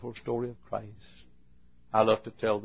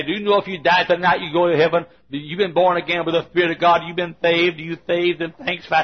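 high, loud, lisping witness; imitated speech impediment; 6 semitones higher, 10 dB louder than usual. Church nut witnessing to a banker, raised lisping voice. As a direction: mimic an eager churchgoer with a lisp. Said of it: "he, he, he, he talked like this".

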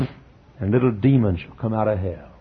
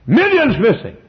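high, louder announcement of the headline; headline cry; 11 semitones higher, 4 dB louder than usual. Acted. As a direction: proclaim it like a newspaper banner.

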